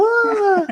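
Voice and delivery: very high-pitched "Ah!"; imitated crowd scream. Host imitating viewers when Rachel and Ross kissed, high squealed "Ah!". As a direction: squeal like a thrilled TV audience. Mimics the world's reaction to kiss.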